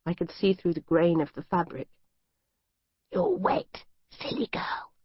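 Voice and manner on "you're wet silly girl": high eerie whisper; captor's whisper. Acted. Captor whispering to the hooded girl, eerie and hushed. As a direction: breathe it like a creepy captor. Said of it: "in his muffled whisper".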